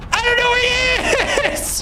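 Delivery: squealed high in disbelief; high frustrated whine. Real, not acted. Streamer lost the survivor, high frustrated whine.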